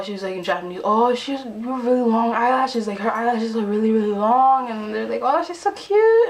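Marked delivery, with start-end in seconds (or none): 0.8-4.7 raised, gushing wonder; imitated amazement. Imitating girls marveling at eyelashes. 5.2-6.3 high, loud, drawn-out squeal; mock fangirl squeal, stretched out; imitated gushing. Imitating girls fawning over her.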